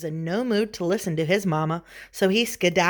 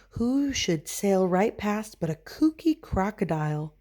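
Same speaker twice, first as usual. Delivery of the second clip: plain reading voice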